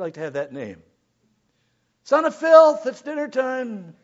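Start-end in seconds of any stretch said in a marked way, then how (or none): 2.0-3.9 high, loud parent call across the house; hollering the kid in for dinner; mock parent calling son of filth to dinner; calling a child.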